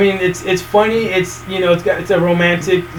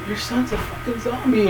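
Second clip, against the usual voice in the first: hushed retelling; 3 semitones higher, 9 dB quieter than usual. Retold or imitated. Voices shocked townsfolk in retold movie.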